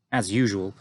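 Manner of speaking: ordinary delivery, nothing marked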